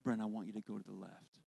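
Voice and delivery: low, soft voice of God; God's voice. Retold or imitated. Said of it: "I hear that voice say".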